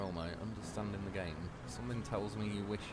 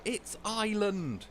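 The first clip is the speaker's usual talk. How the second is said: high, drawn-out exasperated teacher correcting; mock angry correction; 11 semitones higher, 3 dB louder, much slower than usual. Teacher mad at a child misreading, imitated angry correction. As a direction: slowly snap the right word, "EYE-lund", much higher. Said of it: "went mad at me".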